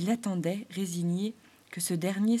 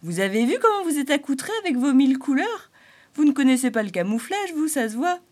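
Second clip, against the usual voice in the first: much higher, louder huffy creature voice; Arcan offended; 6 semitones higher, 9 dB louder than usual. Acted. Springtail scolding the clown's colours, huffy.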